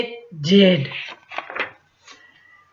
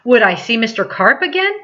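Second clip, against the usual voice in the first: ordinary delivery, nothing marked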